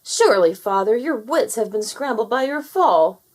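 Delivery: loud, indignant protest; angry son arguing with the king; furious. Acted. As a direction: scold your father loudly. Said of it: "Fowler was furious".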